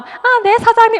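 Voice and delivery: imitating mom's sweet, high reply to other partners; high, sugary "yes, boss"; sweet polite reply. Mother answers other partners sweetly.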